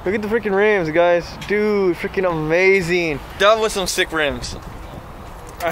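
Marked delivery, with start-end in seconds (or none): none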